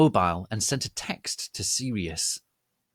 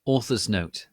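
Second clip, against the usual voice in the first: ordinary delivery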